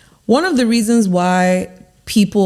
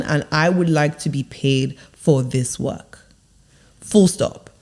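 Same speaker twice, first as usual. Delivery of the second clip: lower, firm and deliberate; speaking downwards; 4 semitones lower than usual. Shown as a demonstration. Coach showing how to ask for fair pay, speaking downwards. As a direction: state it with conviction, letting each phrase drop. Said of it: "you want to speak downwards".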